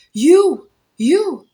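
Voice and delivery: mock the husband's furious jab; hammed-up accusing husband; angry accusation. Husband confronting narrator at gate.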